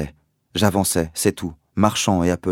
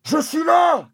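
high loud reply; trapped father answering his son, shouting; distant shout; 5 dB louder, slower than usual. Acted. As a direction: call back to be found. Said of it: "criait la voix".

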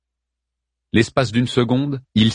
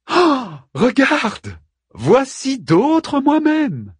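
much higher, very slow, cheerful; jovial; much slower than usual. A narrator's reading. Ariel jovially showing Arman the other creatures. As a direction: announce it gleefully, high and drawn out. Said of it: "dit-il d'une voix joviale".

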